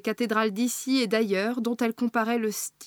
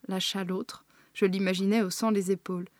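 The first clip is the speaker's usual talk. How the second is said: plain reading voice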